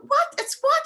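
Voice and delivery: high-pitched mock bewilderment; incredulous high questioning; baffled mummy. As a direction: imitate a mother who can't follow her child. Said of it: "And she was going".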